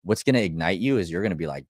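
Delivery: ordinary delivery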